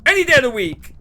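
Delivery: loud, high-pitched outburst; indignant yell. Real, not acted. Streamer settling the whispering argument, indignant yell. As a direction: yell it high, driving the point home.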